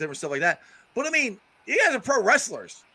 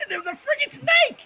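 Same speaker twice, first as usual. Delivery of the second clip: amazed. Real, not acted. Laughing at the snake story.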